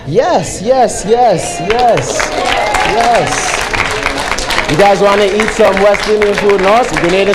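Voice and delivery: ordinary talk, nothing marked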